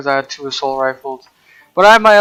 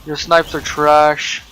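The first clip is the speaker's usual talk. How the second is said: slow taunting; slower than usual. Real, not acted. Repeats slowly for effect.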